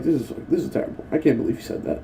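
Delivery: mock offended murmur. Classmates reacting to the joke, a soft mock gasp. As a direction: mumble it like a scandalized classmate. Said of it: "they're like".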